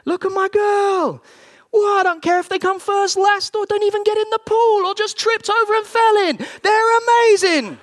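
very high, loud, overjoyed yelling; exultant fatherly shout. Retold or imitated. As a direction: shout like a father wildly proud of his child. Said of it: "He looks on you and says".

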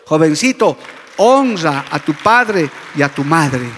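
loud, slow exhortation; preaching shout. Real, not acted. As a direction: command the youth loudly and slowly. Preacher exhorts youth loudly. (exhortación fuerte y lenta)

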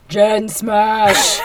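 a loud monster roar of a line; big comic-book smash shout; hammed-up She-Hulk. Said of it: "she didn't get to say".

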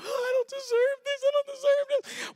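high squeaky voice, far above his own; overwhelmed groom. Retold or imitated. Groom at the altar squeaking his disbelief. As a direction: squeal it like a giddy, unworthy groom. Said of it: "I'm like".

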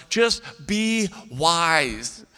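very slow, word by word; painfully slow, spaced-out appeal; pleading parent. Acted. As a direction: drag out each word as a parental plea.

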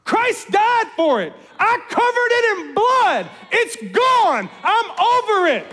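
high, slow and emphatic, completely done with it; God's voice. Retold or imitated. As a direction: declare it finished and forgiven. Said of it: "And God's like".

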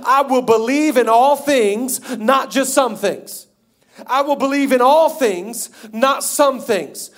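high, loud, slow emphatic declaration; preached confession. Real, not acted. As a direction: drive home the contrast slowly and forcefully. Leads third confession.

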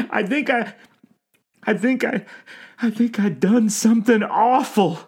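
high-pitched tearful confession; anguished old man, stammering and blubbering; crying. A narrator's reading. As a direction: confess it haltingly through tears. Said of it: "The old man cried".

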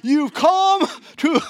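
high, loud, drawn-out war cry; rally the troops from horseback; rousing battle cry. Retold or imitated. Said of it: "Picture him riding on that horse with the flag".

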